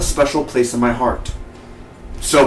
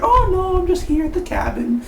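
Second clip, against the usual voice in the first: very high mock woman's voice; Peggy. Woman telling caller she's at the cabin, high mock falsetto. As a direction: imitate her in an exaggerated falsetto. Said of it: "Peggy was like".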